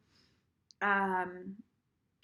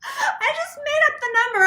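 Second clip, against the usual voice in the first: much higher and louder, laughing through it; laughing confession; 8 dB louder than usual. Real, not acted. Admitting to viewers she made it up, laughing. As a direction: crack up at your own bluff. Laughing at her own bluff.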